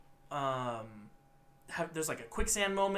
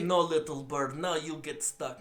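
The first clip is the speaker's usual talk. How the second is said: lightly imitated Eastern European accent; slower than usual. Imitating the evil museum lady, light Eastern European accent. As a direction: put on a mild Eastern European villain accent. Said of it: "an Eastern European kind of accent".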